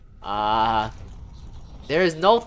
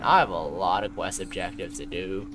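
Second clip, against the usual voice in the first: lower, softer, worn-out voice; tired suffering voice; 9 semitones lower, 6 dB quieter than usual. Shown as a demonstration. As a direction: say it like you're exhausted. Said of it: "the tired, suffering voice".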